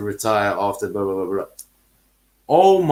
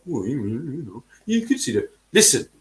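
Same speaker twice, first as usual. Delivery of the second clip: mock club owner. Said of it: "try and be the".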